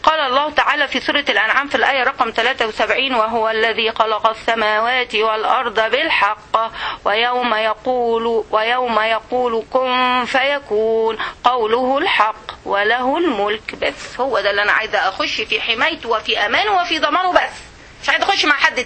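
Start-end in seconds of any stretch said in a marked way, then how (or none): 3.1-13.7 slow, low, measured chant; Quran recitation. Acted. Teacher reciting a verse to her class, slow low recitation. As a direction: draw out the vowels in reverent recitation.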